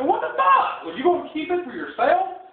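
high-pitched indignant challenge; acted-out outrage, voice jumping up; incredulous confrontation. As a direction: demand it in disbelief. Acted confrontation over hidden plate.

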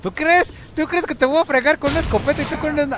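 high, loud incredulous shout; outraged shout. Real, not acted. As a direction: yell it in disbelief. Outraged mocking challenge.

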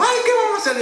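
imitating his wife in a panic over the lost job; hysterical wife. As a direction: wail it like a frantic wife. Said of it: "bien histérica".

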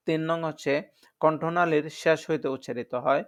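ordinary talk

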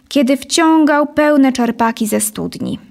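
plain reading voice

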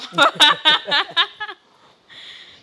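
high, loud burst of laughter. Real, not acted. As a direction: laugh hard at her own impression. Laughs after her impression.

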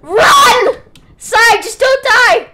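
high loud yell to flee; panicked shout. Real, not acted. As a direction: scream at your friend to run. Fleeing attackers, yelling to friend.

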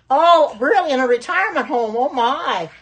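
a high, loud "oh, really?" in real surprise; surprised. Real, not acted. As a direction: react with genuine disbelief to the comment. Reacting to a viewer comment.

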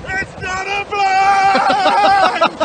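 high, slow, laughing squeal. Real, not acted. Laughing at the fish.

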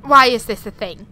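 loud dismayed question; exasperated outburst. Real, not acted. Disgusted by a creepy game object, exclaiming loudly. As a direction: blurt it out in horror.

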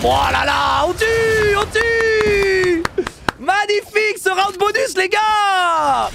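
high, shouting triumph; excited shout. Real, not acted. Team wins the round.

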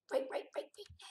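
tense, voiceless whisper. Real, not acted. Player sneaking past zombies, tense whisper. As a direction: hush it so the zombies won't hear.

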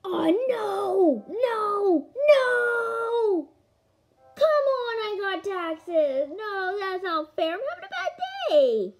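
high-pitched wailing protest; mock tantrum. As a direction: wail it like a hammed-up meltdown. Game turn brings taxes, mock tantrum.

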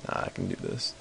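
whispering to himself; breathy whispered pep talk; whispered self-encouragement. Real, not acted.

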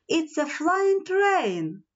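mock shout of wonder, slowly; children's amazed cry, lightly acted; storybook shout. A narrator's reading. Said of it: "Timmy and Nelly shouted at once".

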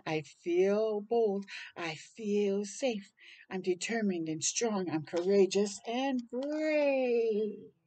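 gentle bedtime story voice encouraging courage; reading to children. A narrator's reading. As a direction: read it slowly and reassuringly to little ones. Reads rhyming picture book aloud.

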